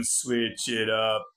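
"switch it up" drawn out slowly, stressing "switch"; slow deliberate. Shown as a demonstration. Demonstrating his meme line, slow and drawn out. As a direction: stretch "switch it up" out like a catchphrase. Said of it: "it goes like this".

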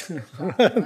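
brief amused chuckle; quick laugh. Real, not acted. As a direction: chuckle as the vote starts. Chair laughs at needing nomination.

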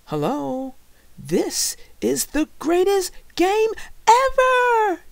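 put-on high, loud gushing boy; over-the-top high-pitched enthusiasm; excited exclamation. A narrator's reading. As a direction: shout it out, stretching the end. Dan exclaims at Drago's question.

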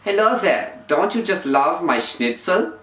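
mock German accent, slow; hammy German host offering schnitzel. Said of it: "German guy".

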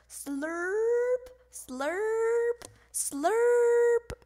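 long high slurps, last one loudest; drawn-out noisy noodle slurping; slurping sound effect. A narrator's reading. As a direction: stretch each slurp, sliding upward. Noisy spaghetti eating.

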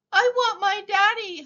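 high, loud little howl. A narrator's reading. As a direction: howl like a tiny child wanting a parent. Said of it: "howled the very small".

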